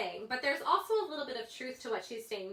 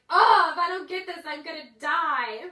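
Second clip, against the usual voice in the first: hammed-up whiny cry, much louder and higher; mock dramatic wail; 6 semitones higher, 14 dB louder than usual. Mimicking her kids with a loud, overdone wail. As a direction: overplay it like a kid who can't get his way. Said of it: "she is a tad dramatic".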